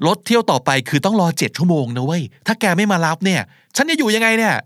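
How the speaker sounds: high, dismayed complaint rising at the end; exasperated pleading. Acted. Stranded caller pressing friend to come, exasperated pleading. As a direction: complain like you're stuck with no ride.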